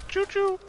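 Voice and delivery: high piping "choo-choo"; train whistle imitation. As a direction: toot like a toy train.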